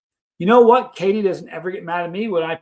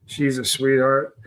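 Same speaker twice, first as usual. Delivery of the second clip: usual speaking voice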